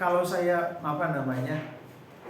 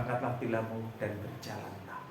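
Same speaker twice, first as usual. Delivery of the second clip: Jesus speaking softly; 6 semitones lower, 8 dB quieter than usual. Acted. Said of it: "Lalu Tuhan mengatakan".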